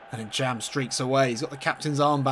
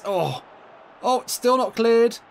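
excited; 7 semitones higher, 3 dB louder than usual. Real, not acted. Scramble in the goalmouth.

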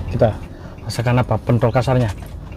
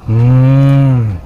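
savoring hum; 3 dB louder than usual. Real, not acted. Tasting bakso, savoring.

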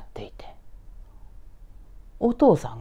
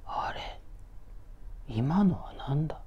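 dazed murmur; 2 semitones lower, 8 dB quieter than usual. Acted. Confused man back in toilet.

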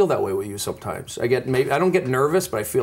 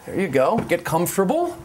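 ordinary talk, nothing marked

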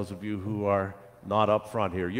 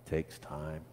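low, soft word of caution; 5 semitones lower, 9 dB quieter than usual. A narrator's reading. Gardener to waiting children, low and soft caution. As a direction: drop it down gently, as a caution. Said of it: "The gardener cautioned".

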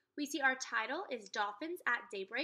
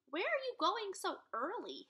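dad calling; 8 semitones higher than usual. Acted.

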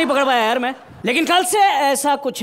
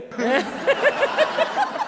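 much higher cackling laugh; 11 semitones higher, 4 dB quieter than usual. Real, not acted. Inspector cackles at his own pun, high.

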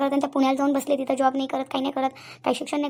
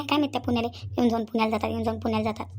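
normal talking voice

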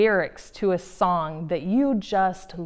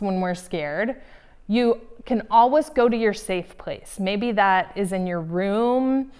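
normal talking voice

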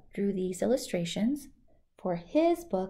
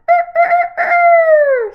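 rooster crow; 16 dB louder than usual. Acted. Rooster crows.

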